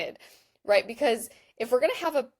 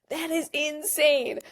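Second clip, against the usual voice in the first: mock amazement; 6 semitones higher, slower than usual. Mocking the app's fancy feature list.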